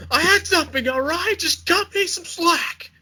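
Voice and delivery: high, whiny excuse, way up in the head. Acted. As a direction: plead for slack in a high whine. Voicing the stuffing eater.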